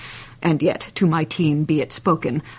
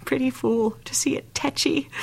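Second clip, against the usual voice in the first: hushed aside; 5 semitones higher, 4 dB quieter than usual. Acted. Nurse recalls fretful weaned baby.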